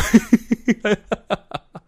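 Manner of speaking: high, amused laugh; short laugh. Real, not acted. Laughs at own age mix-up.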